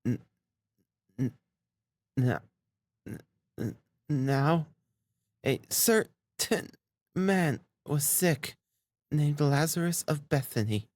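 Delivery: high, strained stammer with halting gaps; faltering, breaking voice. A narrator's reading. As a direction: struggle to get the first syllable out, then strain on haltingly. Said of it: "her voice broke like an overstrained string".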